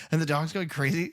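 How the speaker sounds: normal talking voice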